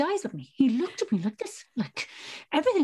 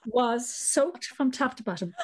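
ordinary talk, nothing marked

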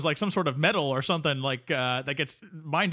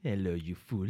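low, growled French-Canadian nemesis voice; French-Canadian villain; 11 semitones lower, 8 dB quieter than usual. Acted. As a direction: play the smug Quebecois villain, low and sneering. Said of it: "Quebecois Dave, our French-Canadian friend".

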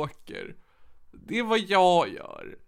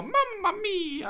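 slow, stretched, higher mock Italian cry; 11 semitones higher, much slower than usual. Cohost mocking her taxi ride with strangers, fake Italian. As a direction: ham up a cartoon Italian.

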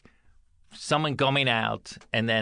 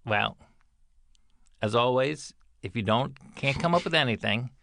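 ordinary delivery

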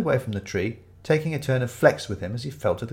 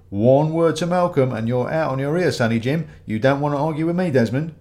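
raised, insistent bully's tirade; bullying customer; 4 semitones higher, 5 dB louder than usual. Acted. Ramage threatens Desmond over the tree, bullying and insistent. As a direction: lean on him with mounting threats.

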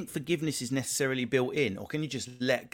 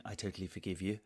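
passive aggressive forgiving; 4 semitones lower, 10 dB quieter than usual. Retold or imitated. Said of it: "a bit of a passive aggressive".

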